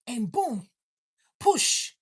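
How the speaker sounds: dramatic mock push; birth push. Acting out a birth.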